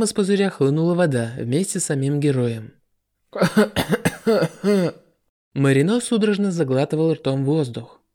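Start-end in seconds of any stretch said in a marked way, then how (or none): 3.3-4.9 spluttering cough after near drowning. A narrator's reading. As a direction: cough up water, gasping. Marino escapes water bubble.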